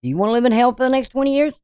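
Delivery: pressing repeat. Real, not acted. To viewers, warning of twenty years in hell, fast urgent push. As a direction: hurry the question home to drive it in.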